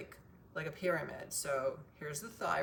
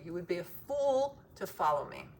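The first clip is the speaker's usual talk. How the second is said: ordinary talk, nothing marked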